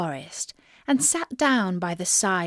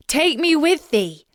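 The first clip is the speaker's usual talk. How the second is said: high, louder plea; frog calling loudly; 7 semitones higher, 5 dB louder than usual. A narrator's reading. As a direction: call after her as loud as you can. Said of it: "as loudly as he could".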